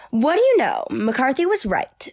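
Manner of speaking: high, loud acted surprise; acted exclamation. Character discovering McCarthy was right, loud. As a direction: announce it like a revelation.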